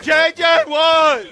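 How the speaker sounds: high, long drawn-out yell; stretched, high-pitched shout. Real, not acted. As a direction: yell the name slowly across the room. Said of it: "You don't have to do that".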